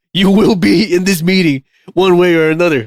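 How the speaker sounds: mock commanding manager voice; mock bossy boss. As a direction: lay down the law like a petty boss, half laughing. Mocking the bossy meeting leader.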